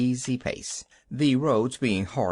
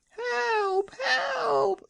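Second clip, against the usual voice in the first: very high, crying scream; screamed for help. A narrator's reading. Man screams for help at the Wizard. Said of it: "screamed the man".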